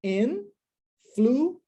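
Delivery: "influenced" very slow, in... flu... enced, pauses between; syllable by syllable. Shown as a demonstration. Teacher shows no new syllable, "influenced" drawn apart in three. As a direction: break "influenced" into three, final t on the last. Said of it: "não posso criar uma nova sílaba".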